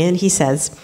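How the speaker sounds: ordinary talk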